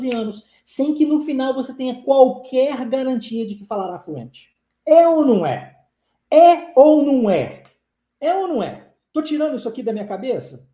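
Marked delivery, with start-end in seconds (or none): 4.9-8.9 loud, pressing question; emphatic challenge. Real, not acted. Teacher challenging students to admit it, loud and insistent. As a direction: demand an answer from the audience.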